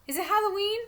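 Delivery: put-on high, rising small-child voice; little boy asking. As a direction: ask like a puzzled young child. Small brother asks innocent question.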